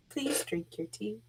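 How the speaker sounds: soft hushed urging; quiet coaxing plea. Acted. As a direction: whisper a pleading request. Character urging tea again.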